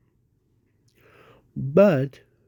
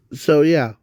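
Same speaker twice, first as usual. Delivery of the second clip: normal talking voice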